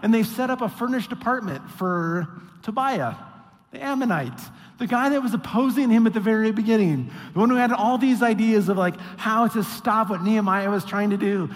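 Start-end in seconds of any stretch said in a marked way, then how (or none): none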